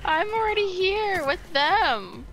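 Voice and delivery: ordinary talk, nothing marked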